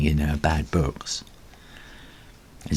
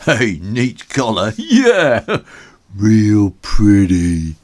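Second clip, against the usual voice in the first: sneering slow mock praise; mocking taunt; 6 dB louder, much slower than usual. Bully mocking Ginger's new collar, sneering. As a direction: drawl it out to tease.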